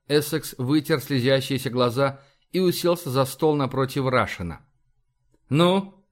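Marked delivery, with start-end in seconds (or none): 5.5-6.0 short impatient demand, pitched up; a mildly irritated ну, prodding for an answer; displeased. A narrator's reading. Essex impatiently pressing Izya over the device, mildly displeased. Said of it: "спросил он недовольно".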